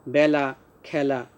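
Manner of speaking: বেলা, খেলা slowly, both with open ae; slow pronunciation drill. Shown as a demonstration. Teacher recaps for pupils, slow open vowels. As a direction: space out বেলা and খেলা, byala, khyala. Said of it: "এভাবে উচ্চারণ করব".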